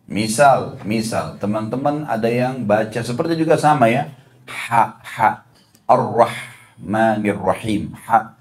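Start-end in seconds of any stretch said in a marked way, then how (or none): 4.5-7.9 "ar-rahmanir rahim" slow, with ha like air escaping; breathy Arabic ha. Shown as a demonstration. Teacher to congregation, demonstrating airy ha, slowly. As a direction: let the ha out like wind, slowly. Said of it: "Jadi seperti keluar angin".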